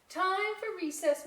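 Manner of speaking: high, loud announcement; teacher announcing. Acted. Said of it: "Miss C announced".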